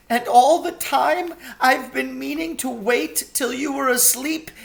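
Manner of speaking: high, anxious faun voice; a guilty faun owning up to his plan; remorseful faun. A narrator's reading. Faun confesses his orders.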